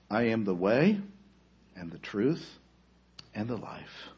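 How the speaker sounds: low, fading to near silence; soft divine voice. Acted. God's voice answers Afshin, fading soft and low. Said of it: "And the voice replied".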